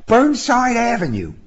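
pitched well up, brisk answer; high-voiced young woman. A narrator's reading. Passenger telling her driver where to go, high-voiced. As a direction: give the address in a high female voice. Narrator voices Sybil giving the destination.

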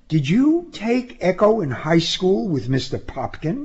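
chatty question in a high female voice; high-voiced young woman, curious. A narrator's reading. Passenger asking about high school, high and curious. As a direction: ask it pitched well up, like a young woman.

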